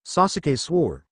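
usual speaking voice

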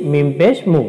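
ordinary talk, nothing marked

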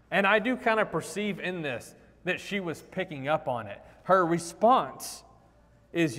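ordinary delivery

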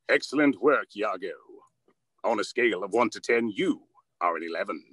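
lightly voiced smooth cartoon villain; Jafar. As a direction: purr it like a scheming vizier. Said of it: "evil Dracula meets Jafar".

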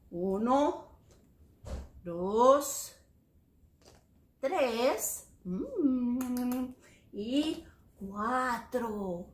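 drawn-out numbers, pausing after each; slow teaching count. Shown as a demonstration. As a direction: count out one at a time with long gaps. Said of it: "Let's count them en español".

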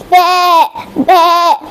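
short, wavering imitated baas; sheep bleat. Animals call to the owl, bleating. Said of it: "called out with the funny voices".

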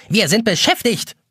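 high, scolding little clock; nagging egg timer. A narrator's reading. Pink egg timer brushing Jonas off, high nagging. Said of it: "keift eine pinkfarbene Eieruhr".

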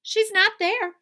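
high, loud dismay; Missus Watson. Acted. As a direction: cry out in acted alarm. Alarmed wife, pig missing.

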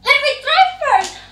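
high, loud demanding squabble; squabbling. Acted. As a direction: push in first, bossy and loud. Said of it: "Ella's stepsisters fight to be the first".

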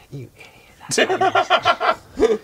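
high, slow, laughing scold; a squeaky villager mocking the first; high comic villager voice, cracking up.

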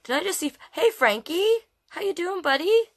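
high, loud delighted call; excited greeting. Real, not acted. Reader spotting a friend calls out excited hello.

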